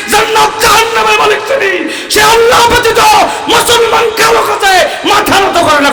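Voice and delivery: high, loud sermon shout; full-force preaching. Acted. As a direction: thunder it out to the congregation.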